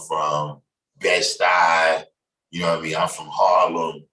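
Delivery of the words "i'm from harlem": ordinary delivery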